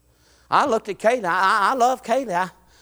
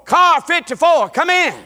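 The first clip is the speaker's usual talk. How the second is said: police radio call; 9 semitones higher, 6 dB louder than usual. Acted. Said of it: "Let me get on the radio".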